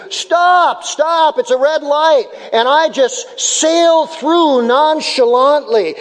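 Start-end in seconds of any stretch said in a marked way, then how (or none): 0.1-2.2 high, half-yelled warning to the driver; mock shout. Passenger warning the driver at a red light, mock shout. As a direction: call out stop like a scared passenger. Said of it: "you shout to me".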